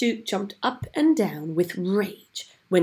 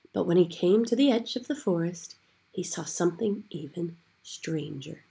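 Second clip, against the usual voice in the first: ordinary delivery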